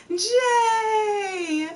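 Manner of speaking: Jay! called out high and loud; excited shout. Real, not acted. Streamer greeting a returning viewer, gleeful call. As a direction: call out the name with a burst of glee.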